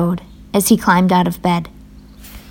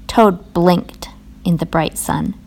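ordinary delivery, nothing marked

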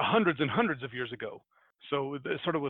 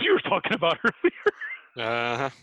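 louder, giggly finish; breaking into laughter; 5 dB louder, slower than usual. Real, not acted. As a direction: laugh through the words. Cracks up during the accented tale.

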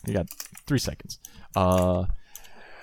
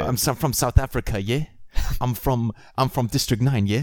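mock South African, a little higher, rising "yeah"; South African accent; 4 semitones higher than usual. Shown as a demonstration. Demonstrating Leo in Blood Diamond, South African accent. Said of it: "you gotta say yeah, like, uh, Leo in".

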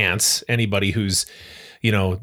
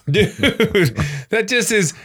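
normal talking voice

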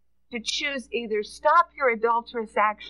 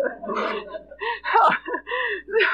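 high laugh breaking toward crying; laughter that tips into tears; laughing and choking up; 9 semitones higher than usual. Real, not acted.

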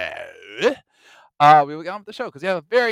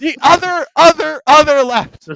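exasperated yelling; 8 semitones higher, 11 dB louder than usual. Retold or imitated. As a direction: drag each word out in despair. Exasperated correction.

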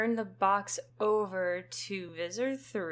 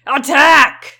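higher, very loud battle order; commanding shout; 6 semitones higher, 18 dB louder than usual. Acted. Alien leader orders the attack, loud commanding shout. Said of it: "says an awful, commanding voice".

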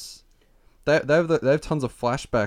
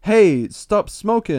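high, loud, slow complaint; acted annoyed protest; 9 semitones higher, 7 dB louder, much slower than usual. Head objecting to smoke in face, annoyed protest. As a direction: call out the objection high and drawn out.

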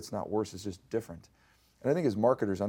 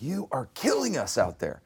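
urgent complaint, higher and slower; quoting colleague; 7 semitones higher than usual. Retold or imitated. Colleague shutting the door to warn her, urgent. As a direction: press the point like a worried coworker.

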